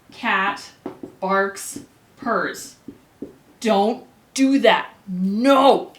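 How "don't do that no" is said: louder, playful stern warning; hammed-up teacher telling-off; mock scolding. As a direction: scold the class half-jokingly. Teacher warns class off a mistake.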